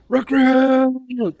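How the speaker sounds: high, loud, long-held shout across the room; drill sergeant yell. Retold or imitated. Drill sergeant calling a recruit across the dining hall, yelling. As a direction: bellow the word across the hall. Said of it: "I hear this".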